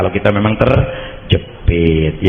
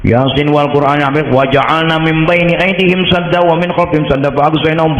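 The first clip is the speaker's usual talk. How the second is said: raised, chanted verse recitation; Quran recitation; 7 semitones higher than usual. Acted. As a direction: intone the verse like casting a spell. Recites verse while throwing. (melantunkan ayat)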